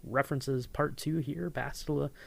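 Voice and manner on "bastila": normal talking voice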